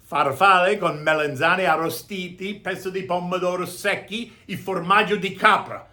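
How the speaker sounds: "farfalle con melanzane arrostiti" said with full Italian vowels and rolled r; Italian pronunciation. Shown as a demonstration. Host naming tonight's dish in Italian, Italian accent. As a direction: savour the dish name like a native Italian speaker. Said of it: "if you wanna say it in Italian".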